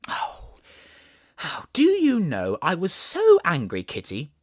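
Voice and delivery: high, loud playful telling-off; Alice mock scolding. Alice telling the kitten how angry she was, playful high mock scold.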